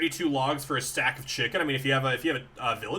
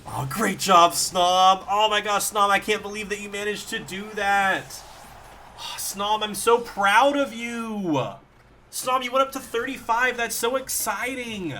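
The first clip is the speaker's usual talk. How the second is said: shower the creature with delighted praise; overjoyed praise; 4 semitones higher than usual. Real, not acted. Snom levels up, streamer elated.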